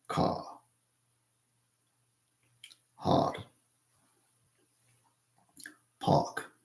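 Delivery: "car, hard, park" with the R's left silent, British style; British dropped R. Shown as a demonstration. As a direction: say "car, hard, park" as "cah, hahd, pahk". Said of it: "you don't wanna stress R's in there".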